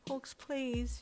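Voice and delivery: high, pleading cry; begging. A narrator's reading. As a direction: beg him, scared. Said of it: "you begged".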